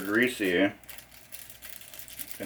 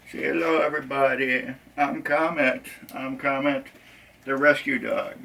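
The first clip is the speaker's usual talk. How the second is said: higher pretend dog voice; voicing the dog; 6 semitones higher than usual. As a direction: speak for the dog, pitched up.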